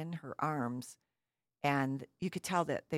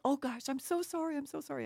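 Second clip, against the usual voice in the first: much higher-pitched mock apology; apologetic parents; 11 semitones higher, faster than usual. Parents apologizing for their screaming toddler, apologetic. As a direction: imitate flustered parents apologizing in a high voice.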